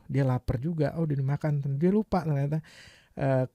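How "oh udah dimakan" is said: normal talking voice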